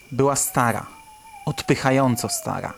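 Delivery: usual speaking voice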